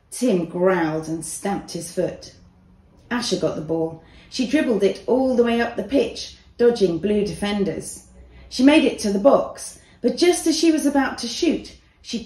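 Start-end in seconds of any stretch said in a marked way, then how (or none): none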